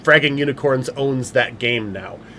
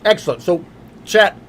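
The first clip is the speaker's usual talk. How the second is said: talking with a mouth full; words around a bite of food; 6 semitones higher, 4 dB louder than usual. Real, not acted. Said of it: "as I talk with my mouth full".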